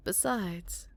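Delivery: normal talking voice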